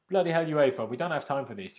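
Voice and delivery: loud, exasperated shout of bloody hell; frustrated outburst. Real, not acted. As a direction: blow up at UEFA for stalling. Fed up with UEFA's slow draw.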